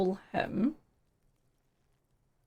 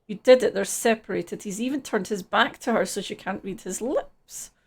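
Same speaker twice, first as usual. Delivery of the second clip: ordinary delivery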